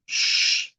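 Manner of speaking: long voiceless shush; hushing "sh". Shown as a demonstration. Teacher demonstrating the first sound of X, sustained shush. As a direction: hush with a sustained "sh". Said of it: "el sonido del silencio".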